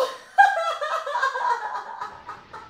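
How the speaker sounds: high helpless giggling; high laughing fit. Real, not acted. Streamer still laughing at the crude poem, high helpless giggle.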